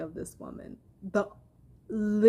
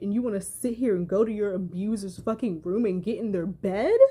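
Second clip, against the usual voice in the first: normal talking voice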